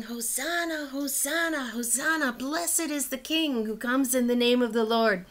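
crowd shouting. Retold or imitated. Teacher voicing the crowd greeting Jesus, mild mock cheer. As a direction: lightly act out the crowd's joyful shouting. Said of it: "and people were shouting".

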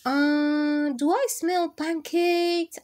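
put-on a boy thrilled by the smell of pancakes; excited sniffing question. A narrator's reading. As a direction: ask it like a kid catching a treat's smell. Danny smells breakfast.

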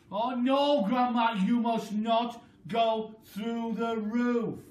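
put-on pleading boy. A narrator's reading. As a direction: beg her not to break the roof. George pleading.

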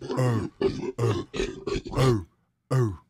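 hammed-up gorilla cheer; gorilla grunts. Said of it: "some gorillas and some grunts".

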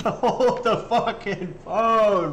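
high and slow, laughing through it; can't get the words out for laughing. Real, not acted. Cracking up at the clip.

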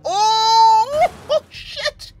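high loud cry; anguished shout. Real, not acted. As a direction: yell in defeat. Killed despite shooting. (हारकर चीखना)

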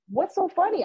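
puzzled what's so funny. Retold or imitated. Retelling a coworker's laughter, asked with high puzzled surprise.

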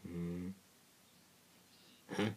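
ordinary delivery

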